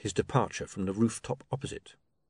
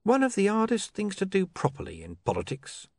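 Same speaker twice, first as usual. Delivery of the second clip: higher, smoothly knowing tone; 9 semitones higher than usual. A narrator's reading. Mister Pin explains politics to his partner, smooth and higher-pitched. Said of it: "said Mister Pin smoothly".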